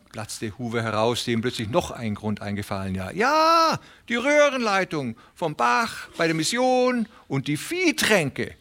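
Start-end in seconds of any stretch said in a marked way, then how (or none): 3.1-8.5 high, fast, fretful little tenant pleading; a nervous little farmhand begging not to lose his place; Hooven the anxious tenant. Acted. Little tenant farmer pleading with Presley, high and anxious.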